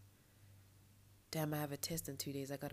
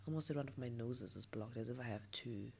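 ordinary delivery

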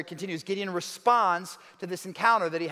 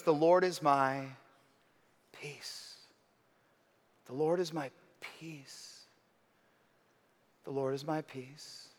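soft, lower and slow, fading to a breath; hushed reverent repetition; 5 semitones lower, 8 dB quieter, slower than usual. Acted. Pastor echoing Gideon's altar name, hushed and prayerful. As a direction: say it like a quiet prayer to yourself.

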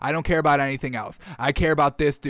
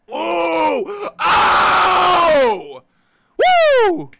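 loud rising whoops of joy; excited whoops; 12 semitones higher, 6 dB louder, much slower than usual. Real, not acted. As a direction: let out a big ecstatic whoop. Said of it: "I had to let that out".

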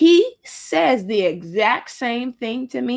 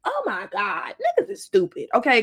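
ordinary talk

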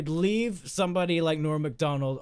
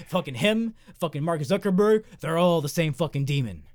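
ordinary delivery

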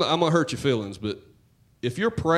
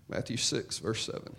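much softer, almost a whisper; breathy near-whisper repeat of "Matthew six, verse seven"; hushed repeat; 2 semitones lower, 10 dB quieter than usual. Shown as a demonstration.

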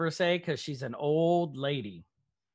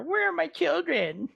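much higher, sing-song mocking echo; 14 semitones higher, 3 dB louder than usual. Agnes mocking Wanda's plea for her children, high and sneering. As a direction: parrot the plea back with a cruel mocking lilt. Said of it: "Agnes starts just mocking her".

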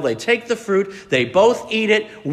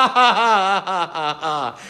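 evil laugh. Acted. Satan laughs in triumph at the fallen pair, evil cackle. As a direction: cackle like a movie devil. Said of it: "most devilish of demonic laughter".

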